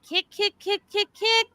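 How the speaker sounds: sing-song high imitation of a child; piping little girl urging a baby to kick; high kid voice. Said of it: "she's going".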